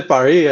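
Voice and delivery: Paris said "pa-REE", no final s; French pronunciation. Shown as a demonstration. Speaker telling the host what won't offend, Paris in French pronunciation. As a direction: leave the s off Paris, French style. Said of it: "you can say Paris".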